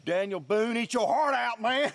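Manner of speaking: high, delighted bragging; giddy high brag over a chainsaw; gleeful excitement. Real, not acted. Gleeful brag after cutting log.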